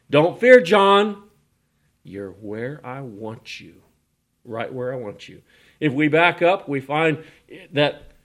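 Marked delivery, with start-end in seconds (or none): 0.1-1.3 loud, high ringing call of God; booming call. Acted. God's voice reassuring exiles. 2.0-5.4 tender reassurance. Acted. God reassuring John softly.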